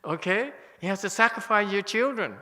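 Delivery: fervent mock demand to followers; excited. Real, not acted. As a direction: push it with teasing zeal. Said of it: "I get very emotional and very excited".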